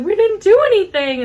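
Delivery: put-on high, loud protesting kid; imitating upset niece. Niece about to cry answering the lady, imitated upset protest. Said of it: "my other niece is gonna cry".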